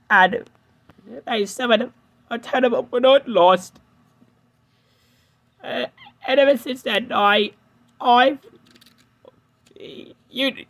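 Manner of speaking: put-on hesitant young engine, shaken; James's voice.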